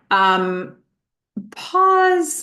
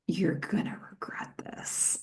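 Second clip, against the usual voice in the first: hushed, voiceless whisper; whispered warning; 13 dB quieter than usual. Retold or imitated. Teddy warning the young man he'll regret it, whispered.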